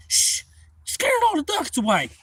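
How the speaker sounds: high-pitched complaint; high loud voice. Retold or imitated. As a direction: scold loudly. Hunter complaining.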